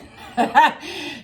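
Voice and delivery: loud high breathy laugh; real laughter; burst of laughter. Real, not acted. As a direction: laugh out at the memory. Laughing at grandmother memory.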